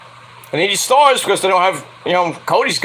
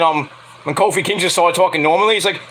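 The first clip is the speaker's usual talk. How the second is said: ordinary talk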